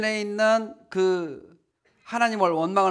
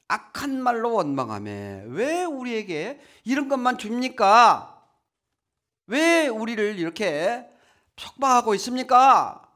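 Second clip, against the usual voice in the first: louder, drawn-out mock protest; grumbling complaint; 5 semitones higher, 6 dB louder than usual. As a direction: whine it up at God like the grumbling crowd. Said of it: "막 이렇게 원망하는 거예요". (크게 원망하듯 항의하는 흉내)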